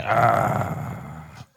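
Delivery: low, soft monster growl; ogre growl. Acted. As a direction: growl low like a mean giant. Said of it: "big ogre, mean guy".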